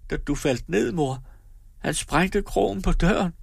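put-on girl telling her mother about her brother; young girl Karen. A narrator's reading. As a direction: lift into the daughter's voice, remembering her brother. Girl's voice voicing Karen.

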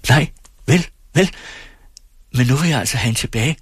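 high, fast, eager demand; eager insistence. A narrator's reading. Singer seizes on the ferryman's admission, high and urgently insistent. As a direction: jump in quickly and press the point.

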